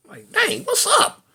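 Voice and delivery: high, soft startled dang; a startled yelp of surprise; mock surprise. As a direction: act caught off guard. Shocked reaction to cell search.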